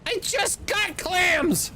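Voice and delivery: high, shouted outburst at a bad find; exasperated shout. Real, not acted. Gamer yelling at the game about clams, high frustrated shout. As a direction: yell it in disbelief.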